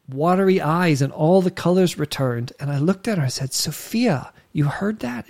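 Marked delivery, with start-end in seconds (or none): 3.5-5.3 softer, higher amazed question; hushed awe. Retold or imitated. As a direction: ask her gently, in wonder. Father awed at daughter's answer.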